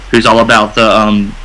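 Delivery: ordinary delivery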